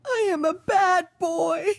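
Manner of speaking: sobbing between phrases; tearful remorse. Acted. Child apologising to parents for the F, tearful. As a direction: apologise through sobs.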